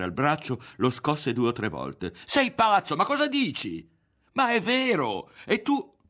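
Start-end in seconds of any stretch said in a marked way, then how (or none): 2.3-3.9 very high, frantic yell; outraged, high-pitched outcry; shouting. A narrator's reading. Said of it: "gridò". 4.3-6.0 very high, loud cry; frantic, high-pitched insistence; desperate protest. A narrator's reading. Berto protests in quarrel.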